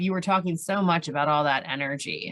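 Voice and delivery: usual speaking voice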